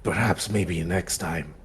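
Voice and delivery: slow, low, quiet threat; soft villain parting shot; menacing Sith. Acted. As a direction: promise a duel in a hushed, dark voice. Haunt threatens Trudeau in character.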